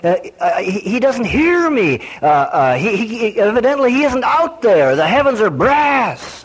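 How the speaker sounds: preacher imitating a despairing churchgoer; whining complainer. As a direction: mimic a stuttering, self-pitying believer. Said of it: "people come to me and say".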